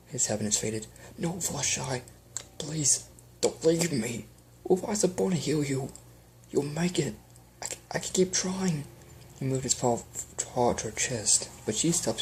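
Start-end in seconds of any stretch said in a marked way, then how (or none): 1.2-8.8 tearful pleading. Acted. Pleading with dying wife.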